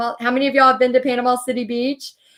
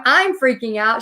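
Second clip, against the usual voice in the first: normal talking voice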